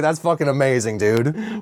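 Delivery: ordinary talk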